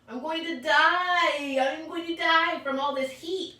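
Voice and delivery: high, loud, slow melodramatic complaint; mock dying of heat; dramatic wail. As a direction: overdo the heat like a drama queen. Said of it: "I said".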